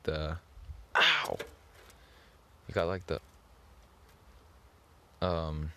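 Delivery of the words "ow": sharp high ow of pain. Shown as a demonstration. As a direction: yelp it out.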